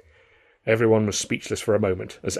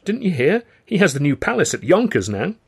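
receptionist voice; 7 semitones higher, 4 dB louder than usual. A narrator's reading. Receptionist explains.

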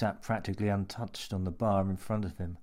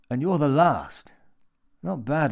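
gloating; 6 semitones higher, 7 dB louder than usual. A narrator's reading. Man gloating.

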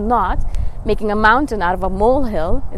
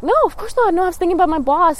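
high, quick reassuring reply; relieved other person; 8 semitones higher than usual. Retold or imitated. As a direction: brush off the worry lightly and quickly. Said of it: "the person will be like".

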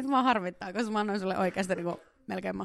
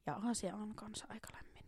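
whispered thinking aloud; whispering to yourself while guessing; muttered to self; 13 dB quieter than usual. Real, not acted. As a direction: say it under your breath. Thinking aloud under breath.

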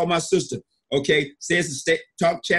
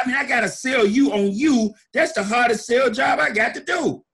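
emphatic; 7 semitones higher than usual. Real, not acted. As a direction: hammer home the hardest sell. Hard sell to listeners.

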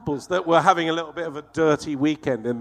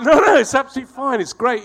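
hasty reassurance; 10 semitones higher, 8 dB louder than usual. Retold or imitated. Hastily reassuring the cabbie, retold.

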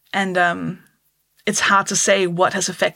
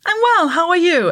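high, louder, sing-song polite answer; polite British reply; 10 semitones higher, 4 dB louder than usual. Retold or imitated. Comic showing the expected British answer, brightly polite. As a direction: chirp it like a well-mannered Brit. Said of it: "the answer to that is".

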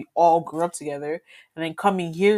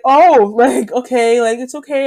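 soothing teacher; 5 semitones higher, 8 dB louder than usual. Retold or imitated. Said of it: "so she's like".

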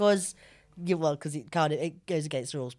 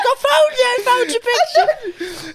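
high, slow, squeaky mock relief through laughter; mock overjoyed Ryan; 14 semitones higher, 5 dB louder, slower than usual. Retelling Ryan finding his lost dog photo, mock overjoyed squeak. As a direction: ham up Ryan's gushing joy at the lost photo.

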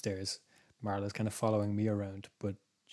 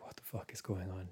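soft unvoiced alarmed whisper; frightened whisper in a dark bedroom; scared whisper; 2 semitones lower, 13 dB quieter than usual. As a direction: whisper it so nothing hears you. Dog staring at the door.

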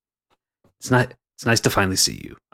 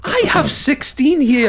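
much higher, slow exasperation; exasperated brother; 4 dB louder, slower than usual. Acted. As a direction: hammer home how many he has. Character scolds his brother.

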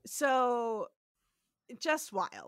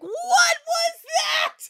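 high, loud, slow wail; mock shocked cry; 11 dB louder, slower than usual. Host recalls watching with mother, hammed-up shocked cry. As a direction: shriek it in stretched-out disbelief. Retold shocked childhood reaction to accent.

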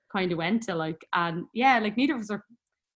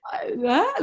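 higher, louder startled what; mock stunned reaction; shocked disbelief; 8 semitones higher, 5 dB louder than usual. Quoting her shocked reaction.

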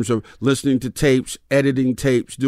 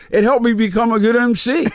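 laughing; 9 semitones higher than usual. Real, not acted. Laughing at the memory.

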